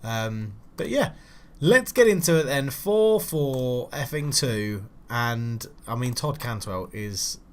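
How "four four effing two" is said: each word slowly stretched out, four four effing two; mock-grand announcement, dragged slowly; drawn-out sarcastic formation call. Real, not acted. Gamer sneering at his team's old formation, slowly drawling it out.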